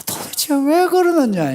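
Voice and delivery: slow, high, loud "why" of despair; exasperated outcry. Acted. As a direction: wail the question in frustration. Acting God's exasperation.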